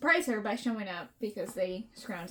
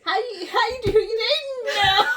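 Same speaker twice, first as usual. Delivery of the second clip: high, loud, giggly mock small talk; overplayed casual prying through laughter; imagined boyfriend tone; 10 semitones higher, 7 dB louder than usual. Boyfriend asking his ex about dating, hammed-up casual tone. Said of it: "only what I could imagine his tone".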